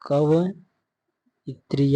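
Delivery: कवइत्री split into "kava", long pause, "itri"; slow broken-up demonstration. Shown as a demonstration. Teacher stressing what to remember, very slow and split. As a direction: stretch कवइत्री out with a gap before इत्री. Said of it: "याद रखना very important".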